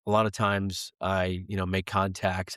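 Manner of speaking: usual speaking voice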